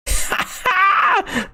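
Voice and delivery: sudden high shrieking laugh. Real, not acted. Joke about bank's reply.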